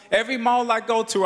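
ordinary delivery

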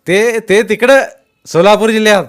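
high, loud retort; shouted dismissal. A narrator's reading. Masked man dismisses the collector's rank, shouting back. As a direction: cut his authority down, shouting.

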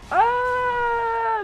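sudden high loud yelp of surprise; startled yelp. Real, not acted. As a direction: jump at the scare. Startled by enemy in game.

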